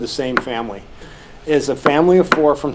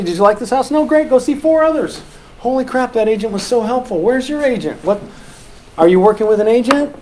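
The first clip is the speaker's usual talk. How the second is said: upbeat host; 6 semitones higher than usual. Acted. Acting friendly open house talk.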